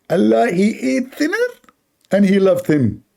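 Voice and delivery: high, slow preaching voice; Zakir Naik impression. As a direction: drag it out like a lecturing cleric. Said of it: "So Zakir".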